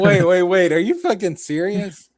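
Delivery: loud, high disbelief; high and loud, can't believe it; stunned shout. Real, not acted. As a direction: yell in thrilled disbelief. Stunned excited reaction.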